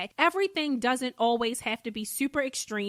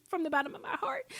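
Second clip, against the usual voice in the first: soft, quick whispered aside, higher pitched; 4 semitones higher, 6 dB quieter, faster than usual. Real, not acted. Host telling her listeners she loves them, quick tender whisper. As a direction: lean in and whisper it like a secret.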